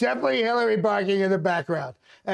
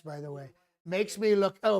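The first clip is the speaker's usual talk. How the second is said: normal talking voice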